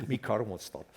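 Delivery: "Me car won't start" in a light, laughing Scottish lilt; a hint of a Scottish accent, "Me" for "my"; mock Scottish accent. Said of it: "doing a Scottish impression".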